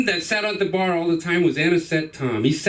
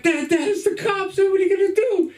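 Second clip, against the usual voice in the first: put-on panicked kid; 13 semitones higher than usual. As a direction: yell for dad in a panic. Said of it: "I run back".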